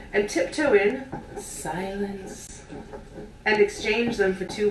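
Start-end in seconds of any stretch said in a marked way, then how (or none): none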